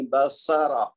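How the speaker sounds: بسارا with a clean, crisp س in the middle; careful pronunciation. Shown as a demonstration. Teacher models بسارا with the س cleanly articulated. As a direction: say بسارا so the س sound is exact. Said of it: "سین کی آواز صحیح مخرج اور صفت کے ساتھ ادا کرنی".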